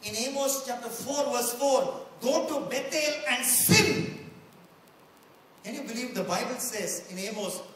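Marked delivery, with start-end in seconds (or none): 2.2-4.3 loud, slow mock proclamation; sarcastic. Preacher voicing Amos mocking the people, loud sarcastic call. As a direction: ring it out high and drawn-out, tongue in cheek. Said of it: "he's actually being sarcastic".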